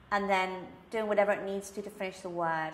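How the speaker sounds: ordinary delivery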